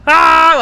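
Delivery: loud, high open-throated "Ah!" yell; stress-venting shout. Shown as a demonstration. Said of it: "tapos sisigaw ka lang na". (malakas na sigaw pampalabas ng stress)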